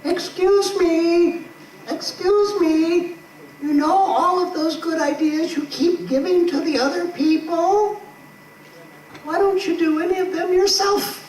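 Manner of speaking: high, slow little voice of conscience; tiny inner voice. Acted. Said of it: "a tiny voice going".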